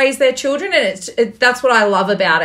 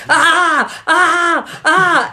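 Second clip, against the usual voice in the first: high playful mock toddler screams; toddler scream imitation; 7 semitones higher, much slower than usual. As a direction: copy a tiny child's happy shriek. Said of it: "Memph started going".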